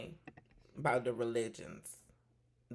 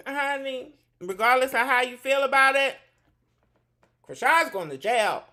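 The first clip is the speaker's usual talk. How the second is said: loud proclamation; 12 semitones higher, 13 dB louder than usual. Real, not acted. Gossip host declaring the verdict on James, loud and high. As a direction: announce it to the whole room.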